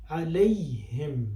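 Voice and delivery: ordinary delivery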